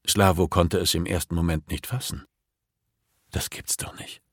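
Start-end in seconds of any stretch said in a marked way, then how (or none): 3.3-4.2 very soft, voiceless disbelief; disbelieving whisper. A narrator's reading. Slavo cannot grasp what he sees, stunned whisper. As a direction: whisper it as if unable to believe it. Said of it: "Ungläubig starrte er auf das Bild".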